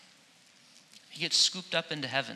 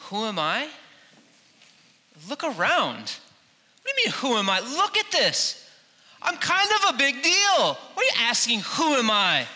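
higher, louder hammed-up braggart; mock bragging; 8 semitones higher, 4 dB louder than usual. John imagined bragging to the delegation, hammed-up and higher. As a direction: puff up like a big shot. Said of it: "all of the things that John the Baptist could have said".

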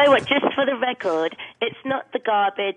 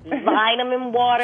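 "Vitamin water" as ˈvɪtəmɪn wɔːtə, slow and louder; slow British "Vitamin water"; British pronunciation; 3 semitones higher, 5 dB louder, slower than usual. Shown as a demonstration. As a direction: say "Vitamin water" the English way, slowly. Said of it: "say it".

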